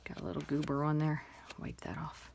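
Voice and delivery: hushed whisper to herself. Real, not acted. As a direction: breathe it out as a private aside. Aside about a smudge.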